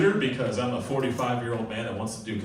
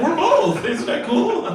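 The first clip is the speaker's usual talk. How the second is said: high, loud gleeful boast; hammed-up squeal of self-congratulation; mock delight; 8 dB louder, slower than usual. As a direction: act thrilled with yourself, pitched way up. Mimics people boasting of being both.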